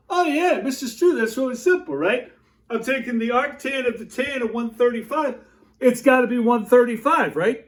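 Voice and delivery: high, eager know-it-all student; imagined student voice. Retold or imitated. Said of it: "what you're thinking is that you're going".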